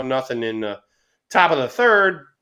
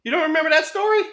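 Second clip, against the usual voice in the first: high-pitched, real disbelief; astonished rising question; incredulous question; 14 semitones higher, 4 dB louder, much faster than usual. Real, not acted. Disbelief that co-host forgot.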